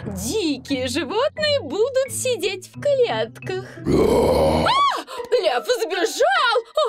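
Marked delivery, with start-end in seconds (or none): 3.9-4.7 scream. Acted. Lion escapes, scream. 5.4-6.8 alarmed shout. Acted. Alarm that lion escaped.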